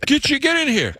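high-pitched mock summons; imitated urgent coworker. New England coworker summoning the Southerner, high imitated urgency. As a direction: order him in, pitched well up.

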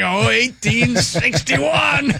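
high, drawn-out outraged cry; mock hollering. Reenactors rejecting anything modern, mock outraged holler. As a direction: stretch it into a hammed-up holler.

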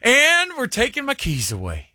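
put-on high old lady voice, drawn out; Martha. Old lady giving up driving on Instagram, high-pitched voice. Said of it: "the way she said it".

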